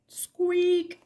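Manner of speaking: high, far-off toy squeak; squeaky rubber duck. Acted. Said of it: "says the little rubber duck".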